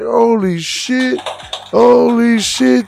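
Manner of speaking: very loud, high, slow drawn-out gasp of awe; amazed crowd. Retold or imitated. Crowd stunned as Tiny Texas walks in, loud slow awe.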